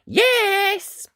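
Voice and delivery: high, bright, loud cheerful yes; small knight's happy yes; eager yes. Acted. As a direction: chirp it out like a keen little boy. Ned eagerly agreeing.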